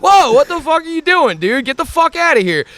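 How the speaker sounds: high, loud startled yell; retold yell. Startled shout at a kid riding at him and his dog. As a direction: bark it at the kid who nearly hit you.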